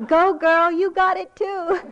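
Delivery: high, loud cheer through laughter; imitated child's shout. Granddaughter cheering Nana on, imitated shout. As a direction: holler like a little girl, laughing. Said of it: "I heard her shout out".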